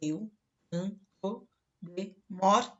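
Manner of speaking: very slow, each syllable stretched out. Shown as a demonstration. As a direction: count the syllables with your voice as you say canto de morte. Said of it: "ele tem cinco sílabas".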